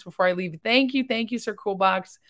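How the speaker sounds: normal talking voice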